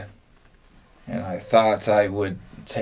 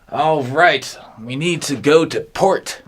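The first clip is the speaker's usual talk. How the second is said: raised, higher calling voice; captain's order; 6 semitones higher, 4 dB louder than usual. Acted. Captain orders the crew to turn, raised voice. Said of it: "And he says".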